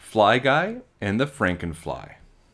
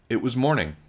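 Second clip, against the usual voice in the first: ordinary delivery, nothing marked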